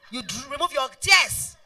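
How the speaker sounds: ordinary talk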